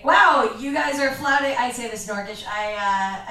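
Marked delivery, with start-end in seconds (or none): 0.0-1.6 higher and louder, a shocked exclamation; mock cop outrage. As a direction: scold the orcs like an outraged officer. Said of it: "and I say".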